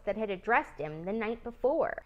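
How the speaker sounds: plain reading voice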